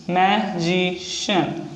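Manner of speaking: slowed teaching pronunciation; slow drawn-out word. Shown as a demonstration. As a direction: stretch each syllable slowly while writing. Repeats word while writing.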